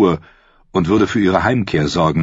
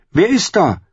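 calling out; 12 semitones higher than usual. Acted. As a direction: call out, startled. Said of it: "rief sie". (laut und hoch ins Dunkel gerufen)

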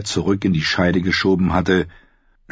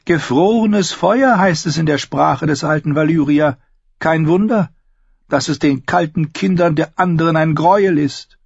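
high, louder feminine character voice; knowing red priestess; 9 semitones higher, 3 dB louder than usual. Acted. Red woman explains frozen fire, high and assured. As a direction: speak high, like a seeress explaining lore.

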